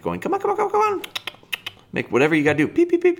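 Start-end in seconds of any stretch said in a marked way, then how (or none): 0.2-1.0 very high, sing-song coaxing call to a puppy; excited recall call. Shown as a demonstration. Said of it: "making a little bit of excitement". 2.7-3.2 very high beeping like a dog whistle; whistle beeps. Shown as a demonstration. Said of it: "beep, beep on the whistle".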